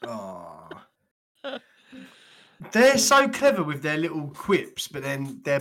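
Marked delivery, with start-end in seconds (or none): 0.1-0.7 low, soft drawn-out "aw"; long sigh. Real, not acted. Co-host reacting to the grim story, long low sigh. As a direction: let out a long falling groan.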